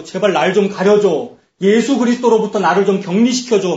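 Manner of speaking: loud, high-pitched acted begging; imitating a frantic appeal; desperate plea. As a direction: cry out to be hidden, pleading loudly. Acted plea of people hiding in fear.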